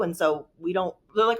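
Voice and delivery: normal talking voice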